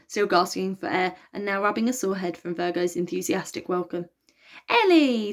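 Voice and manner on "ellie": high, loud delighted scream. Acted. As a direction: scream with joy. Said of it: "screamed Aphrodite".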